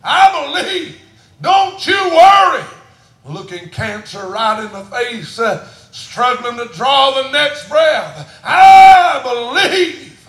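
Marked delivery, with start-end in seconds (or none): 0.0-2.6 much higher and louder, slow at first; building shout. Acted. Preacher voicing the Lord's answer, rising shout. 8.4-10.2 much higher and louder, very slow; shouted I believe. Acted. Preacher proclaiming faith against cancer, full shout.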